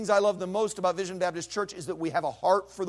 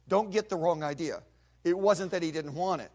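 ordinary talk, nothing marked

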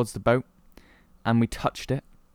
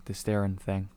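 ordinary talk, nothing marked

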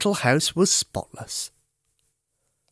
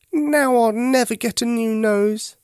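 high, slow forlorn bear voice; sad little teddy; 9 semitones higher than usual. A narrator's reading. Teddy sitting defeated on the stairs, slow and high. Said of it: "sat down on the stairs with his head on his paws".

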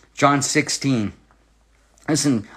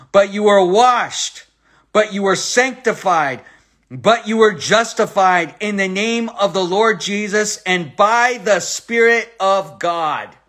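slow, raised pulpit cadence; proclaiming scripture; 4 semitones higher, slower than usual. Acted. Preacher proclaiming washed, sanctified, justified to the church. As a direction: proclaim each but you were.

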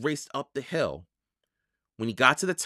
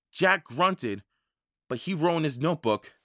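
ordinary delivery

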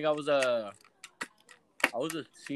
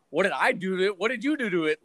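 retold comeback; 6 semitones higher, 4 dB louder than usual. Retold quarrel with rude customer.

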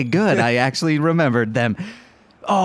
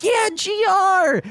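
very high, drawn-out fangirl squeal; cute nerd girl; slower than usual. Acted. Blarm spots G R in the crowd, high squeal. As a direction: squeal it like a starstruck little fan. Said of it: "cute little nerd girl".

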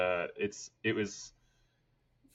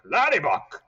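a light Boston-tinged imitation of dad; dad's saying; 9 semitones higher, 9 dB louder than usual. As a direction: echo dad's pet name, higher and louder. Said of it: "he'd call us Laddie Buck".

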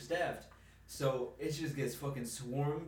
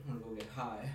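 low, soft grumble; under her breath; 6 semitones lower, 7 dB quieter, faster than usual. Retold or imitated. Retelling the coworker's aside after her meltdown, muttered under her breath. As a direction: mutter it privately, imitating someone fed up. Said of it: "under her breath, she's like".

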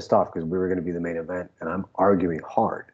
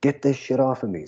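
retold scream; 6 semitones higher than usual. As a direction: imitate his scream without really yelling. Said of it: "And he screams".

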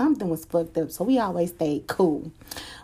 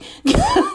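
real laugh; 8 dB louder than usual. Real, not acted. Laughing at her own boast.

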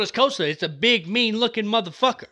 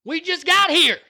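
defiant war cry; 8 semitones higher than usual. Retold or imitated. Rallying listeners to fight, defiant war cry. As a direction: bark it like a marine refusing to fall back. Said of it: "when we said".

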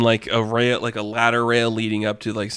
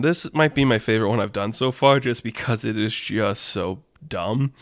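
usual speaking voice

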